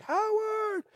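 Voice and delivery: high drawn-out crowd cheer, held back in volume; crowd calling out. Retold or imitated. Audience calling for the singer to appear, high mock crowd call. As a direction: imitate a distant fan shouting a name. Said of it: "people are like".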